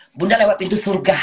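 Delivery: said out loud and firm; firm correction. Real, not acted.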